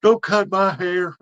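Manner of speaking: high, slow, drawn-out plea; mock whiny kid begging; pleading daughter. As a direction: daughter begging dad not to cut her hair. Daughter pleading in retold story.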